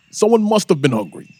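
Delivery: ordinary delivery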